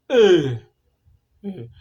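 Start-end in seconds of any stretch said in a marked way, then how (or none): 0.1-0.6 A high and much louder; A as a loud falling call; stressed A, loud. Shown as a demonstration. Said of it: "Syllabic stress".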